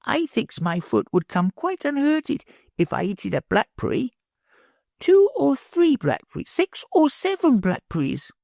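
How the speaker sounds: put-on high playful child voice; small boy Bruno.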